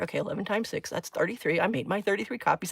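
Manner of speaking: soft retold mutter to herself. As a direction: mutter the sum quietly, as if alone. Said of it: "I started muttering to myself".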